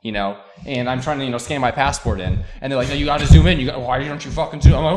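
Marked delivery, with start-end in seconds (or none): 2.9-4.7 mock rude airport worker. Rude airport staff quoted.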